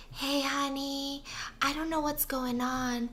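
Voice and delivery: soft, slow, forced-calm ask; a hushed, careful request to his wife; calm and gentle. Retold or imitated. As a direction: keep it soft and calm though rattled. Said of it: "to calmly go upstairs".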